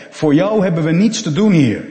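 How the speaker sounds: loud, high dismissal; forceful rejection. Acted. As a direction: send the unwanted stranger away loudly. Villagers reject Jossie seeking work. (luid en afwijzend)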